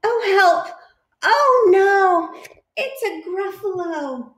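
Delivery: high, loud mock panic cry; mock fright. Mouse sees the Gruffalo, squeals in hammed-up fright.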